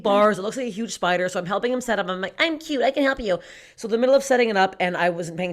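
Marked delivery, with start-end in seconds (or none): 2.4-3.4 higher-pitched cute little helper voice; cutesy offer. Retold or imitated. Woman offers to help her date set up, mock cutesy. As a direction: play up being cute and eager.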